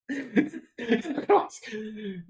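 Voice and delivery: breathless half-crying laugh; teary helpless cackle; wheezing laugh. Real, not acted. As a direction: laugh until it almost sounds like sobbing. Helpless laughter at slaughter bug.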